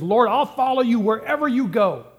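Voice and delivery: enthusiastic. Retold or imitated. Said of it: "He's enthusiastic".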